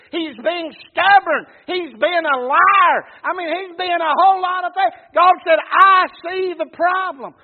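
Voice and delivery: loud, high pulpit shout; fiery preacher hammering each phrase; full-force preaching. Acted. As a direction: thunder it out point after point. Preaches at full force, voicing God.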